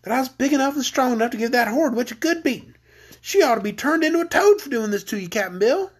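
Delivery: put-on mildly indignant girl, pitched high; a touch of tearful indignation. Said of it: "indignantly, while trying hard to restrain her tears".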